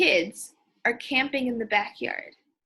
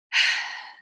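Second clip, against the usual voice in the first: audible breathy sigh. Shown as a demonstration. Girl sighing about the lost cat, audible sigh. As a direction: let out a sad sigh. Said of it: "Did you see how I sighed".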